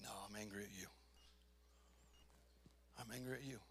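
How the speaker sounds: low, soft admission of anger; hushed confession. Retold or imitated. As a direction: confess it quietly, almost under the breath.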